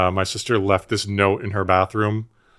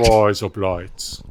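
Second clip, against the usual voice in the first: Australian accent; much slower than usual. Shown as a demonstration. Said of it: "that's how you say us, razor blades in Australian".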